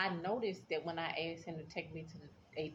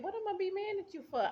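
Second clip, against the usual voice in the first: much higher, mock-incredulous; incredulous retort; 13 semitones higher, faster than usual. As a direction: toss it back in disbelief. Retelling answer to husband.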